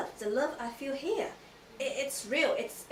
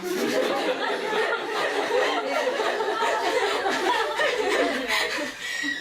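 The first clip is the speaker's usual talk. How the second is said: loud high laugh; 9 semitones higher, 9 dB louder than usual. Real, not acted. Laughing at herself over crying before Pastor Dale, loud high laugh.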